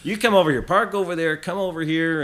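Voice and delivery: hammed-up American border officer, waving someone over; bad American accent. Canadian hunter imitating the border guard's directions, terrible American accent. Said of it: "I'm putting on this terrible American accent".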